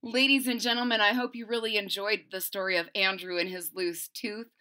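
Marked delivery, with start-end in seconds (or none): none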